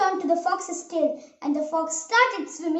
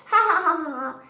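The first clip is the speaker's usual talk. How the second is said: storybook "ha-ha-ha" laugh, falling away; acted laugh. A narrator's reading. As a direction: laugh gleefully, then let it drop. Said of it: "The gingerbread man laughed and said".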